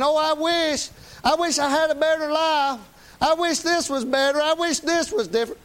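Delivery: high, moaning mockery of a wisher; mock whiny wishing. Preacher mocking people who spend life wishing, high whine. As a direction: lift up high and whine like someone sighing over life.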